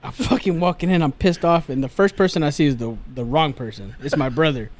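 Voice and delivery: ordinary delivery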